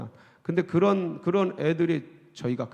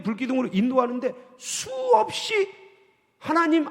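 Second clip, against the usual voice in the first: climbing preacher's call; fiery preaching; 7 semitones higher than usual. Acted. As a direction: preach it out, rising and insistent. Preaching at full force.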